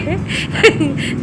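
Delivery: bursting out in loud laughter; a real, helpless laugh. Real, not acted. Mother cracks up at husband's photo, loud laugh. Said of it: "तो मुझे इतनी हंसी आ रही थी".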